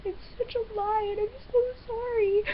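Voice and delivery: on the verge of breaking down; holding back tears. Real, not acted. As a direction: fight the sobs while confessing. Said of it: "not to break out in tears".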